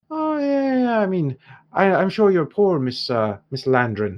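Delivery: Irish dwarf, mock sympathy, high at first; sarcastic dwarf shopkeeper. Dwarf taunts noble hero about her castle, sarcastic. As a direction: needle the rich customer.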